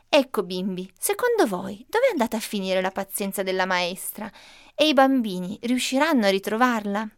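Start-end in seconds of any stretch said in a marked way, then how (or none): none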